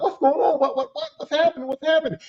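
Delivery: high, bewildered questions; panicked what's happening; alarmed confusion. Retold or imitated. Watching the bouncer grab her, alarmed.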